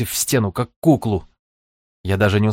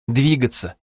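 ordinary delivery

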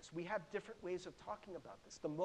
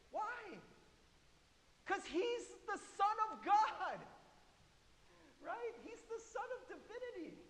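high preaching voice; 12 semitones higher, 4 dB quieter than usual. Real, not acted. Preacher's hushed, high-pitched emphatic reveal.

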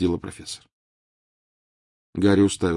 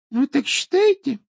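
Trelawney voice; 5 dB louder than usual. Acted.

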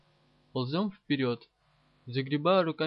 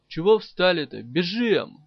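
loud, higher-pitched shouted order; urgent yell; 4 semitones higher, 10 dB louder than usual. A narrator's reading. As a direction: yell at them to get moving. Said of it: "заорал я". (громкий крик, подгоняя)

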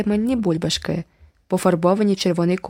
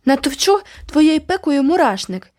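bellowing; 7 semitones higher, 4 dB louder than usual. A narrator's reading. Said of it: "загорлав Джеральд".

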